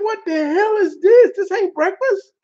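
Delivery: can't believe this is breakfast; outraged disbelief. Retold or imitated. Staring at the cooked tomato, high and outraged. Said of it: "I'm like".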